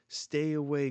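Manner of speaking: loud, high warning cry; a loud, high-pitched call to stay away; urgent shout. Acted. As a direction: shout it to warn him off.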